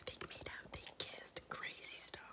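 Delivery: hushed, voiceless gossip whisper. Real, not acted. Vlogger confiding the book's juicy part to viewers, whispering.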